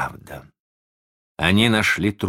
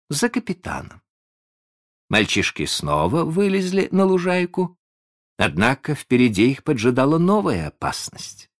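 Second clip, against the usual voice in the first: plain reading voice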